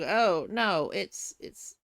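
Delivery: ordinary talk, nothing marked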